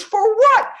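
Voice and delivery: very high, loud, disbelieving question; astonished. Retold or imitated. Son asking mom what the visitors wanted tickets for, astonished. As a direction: sound floored by it.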